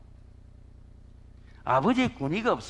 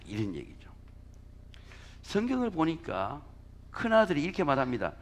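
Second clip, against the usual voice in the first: ordinary delivery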